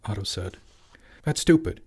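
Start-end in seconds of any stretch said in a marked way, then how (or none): 1.2-1.8 higher-pitched impatient dismissal; irritated outburst. A narrator's reading. Father starting on son, irritated. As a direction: snap it out. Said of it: "Richard started".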